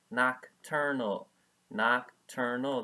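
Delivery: slow, clear "Nocturnal" for the class to repeat; slow model word. Shown as a demonstration. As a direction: say "Nocturnal" slowly, syllable by syllable. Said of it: "Everybody say that with me".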